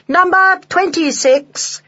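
high, drawn-out shout; slow, high, jubilant call; excited yell. A narrator's reading. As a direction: yell the number like thrilled children. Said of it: "The children yelled louder still".

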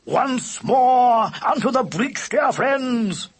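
high, slow, declaimed battle cry; rallying cry. A narrator's reading. Lubber readies a second charge, rallying cry. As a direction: cry it out grandly to rally the troops. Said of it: "he cried".

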